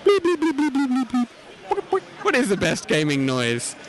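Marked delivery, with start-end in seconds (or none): 0.0-2.0 very high electronic bleeping; high bleeps. Pretend. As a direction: chirp like a game beep. Imitating a game sound.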